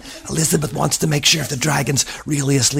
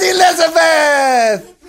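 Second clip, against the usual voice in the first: much higher, louder startled shout; high stretched prince shout; shocked prince cry; 11 semitones higher, 4 dB louder, slower than usual. Acted. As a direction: cry her name in shock. Ronald shocked at Elizabeth.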